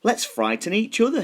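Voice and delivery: high, gleeful little skeleton; a small skeleton with a fun plan. A narrator's reading. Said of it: "he said".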